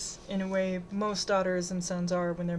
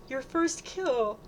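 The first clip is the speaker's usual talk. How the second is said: high, drawn-out, thrilled squeal; slow, high-pitched celebration; delighted yelp; 6 semitones higher, much slower than usual. A narrator's reading. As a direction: yelp with proud glee. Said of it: "Bridget yelps".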